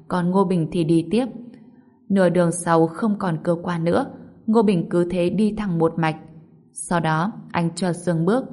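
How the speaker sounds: ordinary delivery, nothing marked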